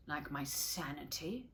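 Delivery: ordinary delivery, nothing marked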